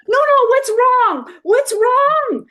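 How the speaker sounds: high, loud tearful wail, slow; crying friend. Retold or imitated. Friend at the door in tears, imitated tearful wailing. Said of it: "she's standing there in tears".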